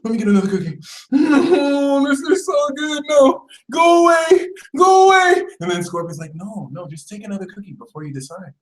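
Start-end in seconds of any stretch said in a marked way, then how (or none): 0.0-3.0 high wail while giving in; hammed-up tearful whine; mock crying. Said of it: "Sagittarius was crying". 3.1-5.4 hammed-up bawling shout; mock crying. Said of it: "Sagittarius was crying". 6.3-8.5 soft, quick, hushed persuasion; quiet, insistent tempter; coaxing Scorpio. Acted.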